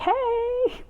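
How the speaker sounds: very high, strained little hey; squeaky mock Armand. Imitating Armand greeting Louis in a squeak.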